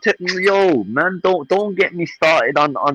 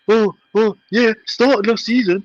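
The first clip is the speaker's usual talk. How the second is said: higher-pitched mock of a rival; mocking quote; 8 semitones higher than usual. Fed-up fan parroting rival's talk about last season, mocking. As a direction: imitate their whingeing in a raised pitch. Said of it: "I'm sick of hearing".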